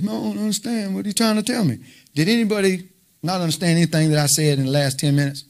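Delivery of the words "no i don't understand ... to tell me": confused reader. Retold or imitated. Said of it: "they start reading and like this".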